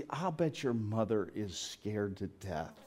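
soft, slow motherly concern; maternal. Retold or imitated. Mom to a lone eighteen-year-old, soft maternal concern. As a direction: imitate a mother's hushed, tender concern. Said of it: "It was maternal".

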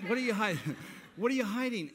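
loud, high mock accusation; mock accusing shout. Pastor mocking the anonymous note writer, loud mock accusation. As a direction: hammer the question like the note did.

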